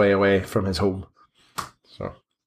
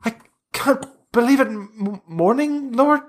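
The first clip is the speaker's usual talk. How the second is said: very high, overcome with joy; ecstatic priest; 12 semitones higher than usual. Acted. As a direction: falsetto gratitude on his knees. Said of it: "he falls to his knees".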